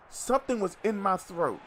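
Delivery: ordinary delivery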